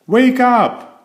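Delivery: high, loud call to wake a friend; excited cry. Acted. Mister Silly rousing his sleeping friend, loud high cry. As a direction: shout him out of sleep. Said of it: "cried Mister Silly".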